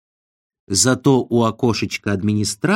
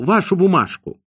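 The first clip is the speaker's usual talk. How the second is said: higher-pitched demand, only lightly raised; mild shout; 6 semitones higher than usual. A narrator's reading. Overworked administrator demanding Ostap's note, calling out. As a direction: call for it briskly, without yelling. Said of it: "крикнул он Остапу".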